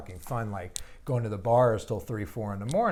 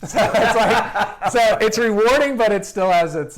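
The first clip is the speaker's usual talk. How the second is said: higher, louder words through laughter; laughing; 11 semitones higher, 13 dB louder than usual. Real, not acted. Dad telling the host about bedtime, laughing through it, higher and louder. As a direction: laugh it out as you speak.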